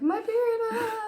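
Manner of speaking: high, loud, hammed-up sobbing friend; mock crying wail. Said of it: "she's just blabbering on again".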